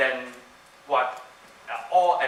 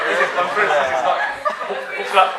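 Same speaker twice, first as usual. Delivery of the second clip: talking through real laughter, louder; laughing; 3 semitones higher, 10 dB louder than usual. Real, not acted. Host to the conference crowd, laughing through the words. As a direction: laughs while joking this is not book club.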